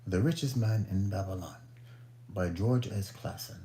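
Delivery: mock-British book announcement; British accent. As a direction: put on a playful British accent. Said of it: "do my little British accent".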